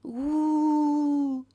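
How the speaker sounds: loud, high ghostly wail. Pretend. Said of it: "This is now Ghost A S M R".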